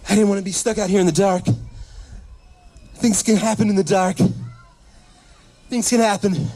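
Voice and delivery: fast, rising, panicky plea; frantic fear. Retold or imitated. Mom explaining to dad why she ran home, scared and frantic. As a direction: spook yourself talking about the dark.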